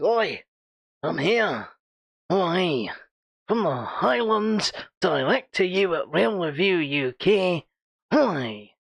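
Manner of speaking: put-on deliberately butchered Scottish accent; mock a friend's Highland Scottish accent, badly; hammed-up, terrible Scottish impersonation. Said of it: "it's the worst Scottish accent ever".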